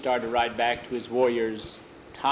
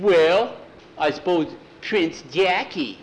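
louder, higher, a touch pompous; lightly voiced Jackie; 5 semitones higher, 5 dB louder, slower than usual. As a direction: try the title on, half proud. Jackie answers in a changed voice.

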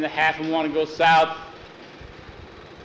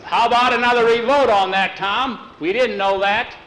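raised, higher shout from the crowd; yelling; 8 semitones higher, 6 dB louder than usual. Retold or imitated. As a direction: call out a demand for a revote. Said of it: "One of the men yells".

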